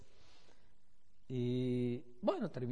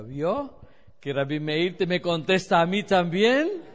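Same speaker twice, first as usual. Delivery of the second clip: louder, gloating told-you-so to the lady; triumphant told-you-so; 3 semitones higher, 11 dB louder than usual. Retold or imitated. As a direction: crow it back at her, pleased with herself. Helper boasting her prayer worked.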